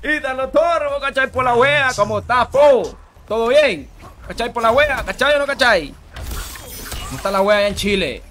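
loud, high Chilean street greeting; Chilean accent. Acted. Streamer greets Ítalo in Chile, loud mock Chilean accent. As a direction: hail him in exuberant Chilean slang accent.

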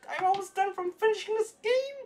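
high-pitched thrilled squeal; excited squeal. Real, not acted. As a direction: let it shoot up high with excitement. Thrilled at nearly completing achievements.

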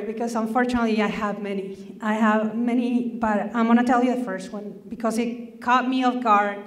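usual speaking voice

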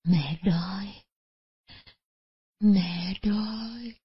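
weak, drawn-out whispered plea from a starving mother; frail old mother's faint whisper; feeble whisper. A narrator's reading. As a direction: whisper it slowly with no strength left. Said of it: "mới nghe bà thều thào".

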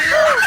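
high sharp gasp of dismay; a short high horrified intake of breath; shocked gasp. Acted. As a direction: gasp in horror. Skeleton loses his food.

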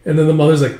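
ordinary delivery, nothing marked

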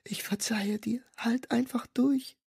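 whispered plea at a deathbed; tearful whisper. A narrator's reading. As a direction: beg him to hold on, softly. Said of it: "murmelte sie weinend".

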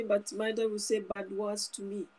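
ordinary talk

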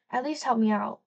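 usual speaking voice

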